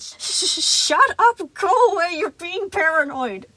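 loud, high furious yell. A narrator's reading. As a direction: scream at him to go. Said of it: "as he yelled".

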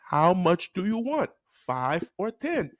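slow, deliberate question; stern teacher. Retold or imitated. Teacher repeats the choice to a confused boy, stern. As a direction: repeat it slowly, leaving each choice hanging.

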